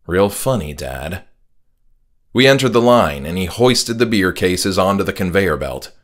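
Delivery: usual speaking voice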